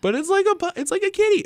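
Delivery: very high, squeaky cute voice. Pretend. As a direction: squeak it like baby talk. Cute voice for the dino.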